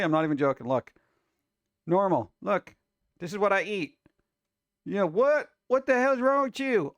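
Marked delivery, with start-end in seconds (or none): 5.1-6.9 loud high mock yell; all caps voice. Imitating an all-caps texter, loud high mock yell. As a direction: shout it like a text in all caps. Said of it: "All caps".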